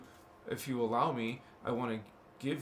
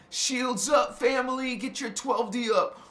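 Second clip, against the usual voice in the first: hyped rally cry; 10 semitones higher, 8 dB louder, slower than usual. Real, not acted. Hyping the chat.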